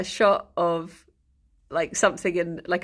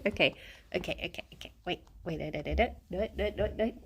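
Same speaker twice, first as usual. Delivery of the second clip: very soft, low repeated words; hushed fussing; 4 semitones lower, 10 dB quieter, much faster than usual. Real, not acted. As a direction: murmur quietly to a pet. Fussing with pet away from mic.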